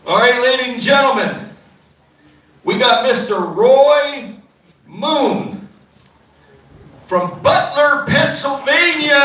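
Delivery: slow, drawn-out announcer call to the crowd; winner announcement. Real, not acted. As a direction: stretch the name out like a ringside announcer.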